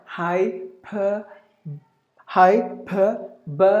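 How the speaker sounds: "hyperbole" as hai, per, buh, lee; very slow four-part "hyperbole"; schwa kept. Shown as a demonstration. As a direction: separate each syllable of "hyperbole", keeping buh. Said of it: "Either way, both are correct".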